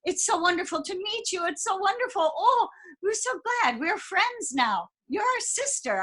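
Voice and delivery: imitating delighted women, very high-pitched; effusive, squealy retold welcome; gushing affection. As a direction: gush over a new friend in a high voice. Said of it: "they were hugging me and patting me and kissing me".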